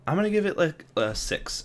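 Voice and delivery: sudden high excited burst, then slowing. Real, not acted. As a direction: blurt the score out eagerly. Excited rating reveal.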